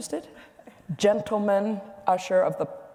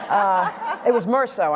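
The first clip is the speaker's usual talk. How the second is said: laughing; 7 semitones higher, slower than usual. Real, not acted. Laughs at her own lunch wine.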